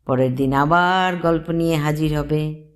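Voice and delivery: ordinary delivery, nothing marked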